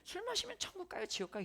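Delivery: put-on high-pitched kid asking; middle schooler. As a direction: play a curious young student. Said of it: "우리는 늘".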